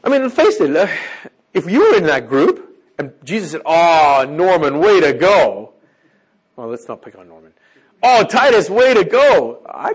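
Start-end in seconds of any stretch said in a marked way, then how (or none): 3.6-5.7 big, booming cheer of congratulation; hammed-up loud, drawn-out praise. Jesus congratulating a disciple before the whole group, loud acted praise. 8.0-9.6 loud, higher-pitched hammed-up congratulation; loud praise. Jesus congratulating Titus in front of the group, loud high praise. Acts out the praise with another name.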